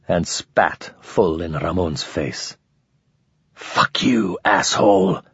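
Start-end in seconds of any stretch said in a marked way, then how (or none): 3.5-5.2 hoarse, rasped curse; rasping. A narrator's reading. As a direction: rasp it out. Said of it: "the other rasped".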